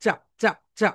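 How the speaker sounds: চা said as a crisp cha three times; cha sound drill. Shown as a demonstration. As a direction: repeat চা, চা, চা with a clear ch sound. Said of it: "কি sound দেয় বলতো". (চা শব্দটা তিনবার স্পষ্ট চ দিয়ে)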